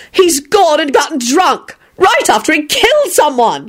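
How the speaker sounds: high, outraged yell; a high scandalised yell. Acted. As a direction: shout it, scandalised. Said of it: "Elizabeth yelled".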